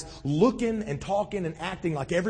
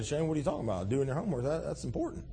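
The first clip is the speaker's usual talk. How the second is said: a hint of a scolding teacher, much softer; mock teacher objection; 4 semitones lower, 9 dB quieter, much faster than usual. Teacher in the audience objects to Shane, softly. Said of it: "The teachers in the audience saying".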